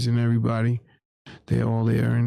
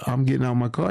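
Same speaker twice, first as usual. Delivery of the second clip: ordinary talk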